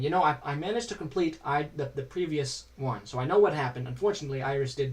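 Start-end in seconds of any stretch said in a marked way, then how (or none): none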